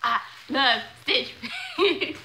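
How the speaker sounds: loud, laughing declaration; real gleeful outburst; giddy delight. Real, not acted. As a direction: proclaim it happily, breaking into a laugh. Gushing over box, laughing.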